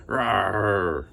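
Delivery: an understated bear roar; lightly roared grumble; mild roar. Acted. Bear losing the game, a mild roar. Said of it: "Old Bear roared".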